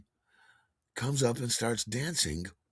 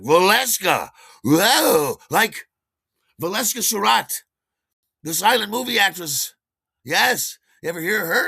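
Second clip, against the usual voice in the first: loud, high burst of delighted recognition; amazed outburst; 11 semitones higher, 10 dB louder than usual. Retold or imitated. Dancer hearing the name of a silent star, amazed. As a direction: exclaim it like a starstruck fan.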